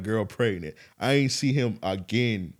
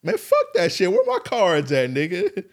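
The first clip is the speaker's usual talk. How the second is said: high, loud indignant yell; shouting where my cards at, much higher and louder; outraged shout; 8 semitones higher, 6 dB louder than usual. Retold or imitated. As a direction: demand the cards back, furious. Outraged demand for his cards.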